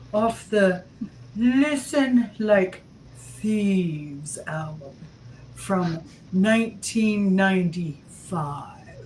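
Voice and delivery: mock Chancellor Merkel accent. Pretend Chancellor Merkel presents her number two, slow German accent. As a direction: play a German leader, stretching each word. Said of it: "thank you, Chancellor Merkel".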